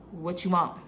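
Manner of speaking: low, unwelcoming retold question; curt reply. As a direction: brush off the boss with a low grumble. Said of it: "That's how I talk to him".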